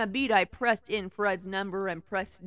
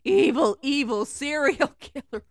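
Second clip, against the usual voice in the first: mock dramatic; 6 semitones higher, 8 dB louder, slower than usual. Mocking the fanfic villain.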